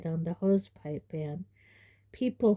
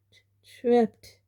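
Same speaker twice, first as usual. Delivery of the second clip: shaky; 5 semitones higher, 7 dB louder than usual. Acted. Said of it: "said Dudley shakily".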